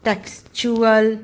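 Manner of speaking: टेक्सचुअल said slowly with च; slow demo of टेक्सचुअल with च. Shown as a demonstration. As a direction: stress the च in टेक्सचुअल. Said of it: "च की sound आने लग जाती है".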